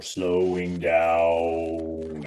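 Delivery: slowed-down slow-motion voice. Shown as a demonstration. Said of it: "weird slow-mo voice trick".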